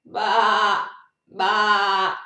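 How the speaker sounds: loud drawn-out sheep bleat; sheep baa. Pretend. Reader answering what sound a sheep makes, bleating. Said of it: "What sound does a sheep make?".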